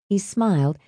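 ordinary delivery, nothing marked

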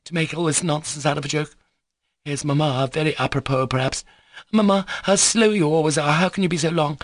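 put-on high, flustered girl's voice; nervous petulant girl. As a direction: rise into impatient scolding of Mamma. Lisa nervous, then chides Mamma.

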